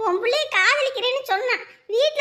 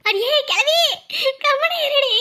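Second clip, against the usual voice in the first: loud, higher telling-off; scolding; 5 semitones higher, 3 dB louder, slower than usual. Acted. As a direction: snap at the old woman to shut up. Scolding the grandmother.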